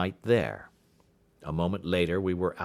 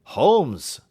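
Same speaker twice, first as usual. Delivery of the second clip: loud, higher call of the name; cry out; 7 semitones higher, 7 dB louder than usual. Acted. Friend crying out to the detective as he grasps it, loud. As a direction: cry out his friend's name in sudden realisation. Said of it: "I cried".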